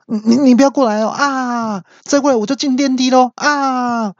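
very high, stammered warning with wails; hammed-up cornered panic; panicked threat. Friend warns guard to stay back, mock panic.

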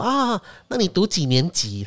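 mock salesman asking politely; salesman question. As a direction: draw out the question like a pushy salesman. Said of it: "然后他就说".

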